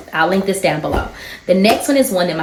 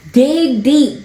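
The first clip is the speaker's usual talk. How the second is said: loud urging; 7 semitones higher, 4 dB louder than usual. Acted. Repeating the urge louder.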